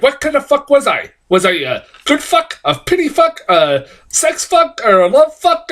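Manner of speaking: high-pitched mock dramatic movie line; overplayed impression of an actress confronting someone; imitating actress's odd delivery. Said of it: "I can't do it justice".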